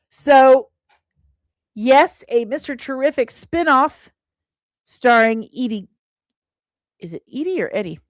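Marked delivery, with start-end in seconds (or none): none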